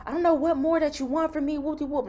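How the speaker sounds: high-pitched imitation of a yelling recruiter; mock yelling. Nurse mimicking recruiter yelling at her down the phone, mock yell. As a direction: rattle off his rant in a high mock shout. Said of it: "he's, like, yelling".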